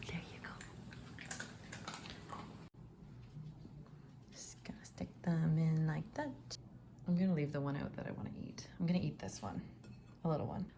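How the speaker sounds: soft unvoiced whisper. Real, not acted. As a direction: whisper so the dog sleeps. Whispering while dog sleeps.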